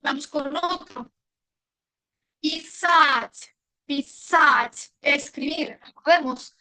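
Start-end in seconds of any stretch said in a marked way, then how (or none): 2.4-4.9 писать with a long, stressed final A. Shown as a demonstration. Teacher introducing the verb to write, stressed A in писать. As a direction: lean on the SAT in писать. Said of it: "Tilde se queda en la letra A".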